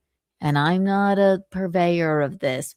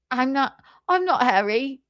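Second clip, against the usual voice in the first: ordinary talk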